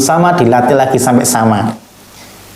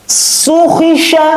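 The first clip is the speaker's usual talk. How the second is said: high, crisp Quran letters; fast, firm recitation; 14 semitones higher, slower than usual. Shown as a demonstration. Teacher shows pupils fast, firm letter reading, high and crisp. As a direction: snap out each syllable firmly. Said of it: "Sekarang baca cepat".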